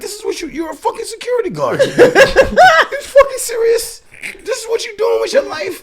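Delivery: hammed-up furious yell; mock shout. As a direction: yell in disbelief, cracking up. Ranting at security guard.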